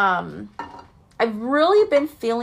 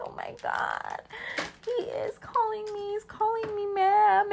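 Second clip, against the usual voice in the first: shocked inner voice; 11 semitones higher, 8 dB quieter than usual. Retold or imitated. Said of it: "And I was like".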